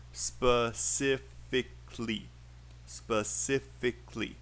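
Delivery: "Specifically" broken into separate counted syllables; each syllable of "Specifically" set apart. Shown as a demonstration. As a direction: count out "Specifically" slowly, beat by beat. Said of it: "ask you to count the syllables".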